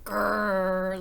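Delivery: loud little growl; playful growl. Acted. Little Dragon growling at his toy boat, a loud playful growl. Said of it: "Little Dragon growled".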